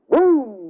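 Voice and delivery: booming thunder sound, high and drawn out; mock thunder clap. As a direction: boom it out like a thunderclap. Preacher imitates thunder.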